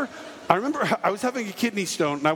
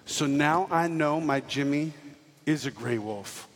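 slow, lower solemn father; padre solemne; 3 semitones lower, slower than usual. Retold or imitated. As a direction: deliver a slow, grave declaration. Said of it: "And then he said this".

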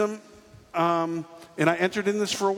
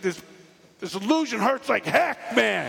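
higher-pitched exasperated outburst; pained protest; 4 semitones higher than usual. Acted. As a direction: complain through mock agony. Acted protest while in pain.

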